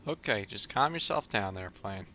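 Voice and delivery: normal talking voice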